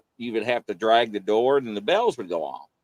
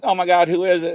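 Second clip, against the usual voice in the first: alarmed; 7 semitones higher, 6 dB louder, faster than usual. Retold or imitated. Scared reaction to psychic.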